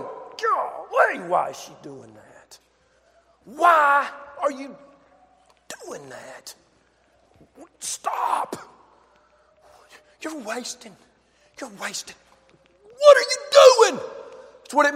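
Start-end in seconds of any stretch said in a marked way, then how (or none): 0.0-12.2 grumbling disciple. Acted. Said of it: "who was going". 13.0-14.0 much higher and louder, incredulous; a disciple scolding the woman; indignant outburst. Acted. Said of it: "who was going".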